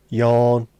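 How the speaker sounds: ordinary delivery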